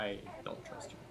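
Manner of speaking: whispered aside; whispering. Real, not acted. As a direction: whisper it warily.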